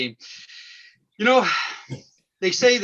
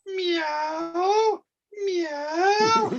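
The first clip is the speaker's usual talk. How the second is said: high, slow stretched meow; creaking cat impression; cat creaky door. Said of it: "my impression of a cat, a creaky door".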